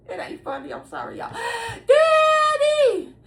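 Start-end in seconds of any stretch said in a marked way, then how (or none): 1.3-3.1 high, drawn-out wail for daddy; long mock cry, high-pitched; imitated hollering. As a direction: holler out like a child calling for a parent. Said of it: "And they hollering".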